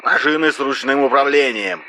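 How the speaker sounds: high voice through a telephone filter; creaky phone voice. A narrator's reading. RS promises equipment, creaky telephone voice. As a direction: squeeze it through the crackly line. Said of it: "проскрипел Р С".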